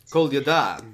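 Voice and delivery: Kolyada stressed on the final "da"; end-stressed Kolyada; practised pronunciation. Shown as a demonstration. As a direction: repeat Kolyada carefully, weight at the end. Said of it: "put the stress on the last syllable".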